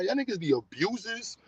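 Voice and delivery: normal talking voice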